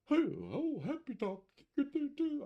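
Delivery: hammed-up high cheerful YouTuber voice; cheery YouTuber parody. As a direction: lampoon a peppy tutorial host. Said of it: "I didn't have Mister".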